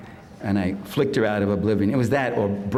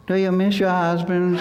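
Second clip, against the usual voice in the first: high, mocking TV interviewer voice; raised mock-sympathetic interviewer, laughing through it; 7 semitones higher than usual. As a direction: ape a syrupy TV host asking widows. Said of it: "and she's asking them".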